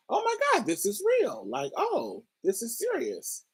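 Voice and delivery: high, breathy-free soft gasp of alarm in her head; a hushed, high inner panic; panicked inner thought. Retold or imitated.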